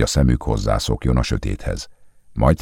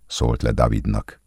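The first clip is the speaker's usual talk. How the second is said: plain reading voice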